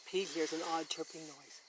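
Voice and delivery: ordinary delivery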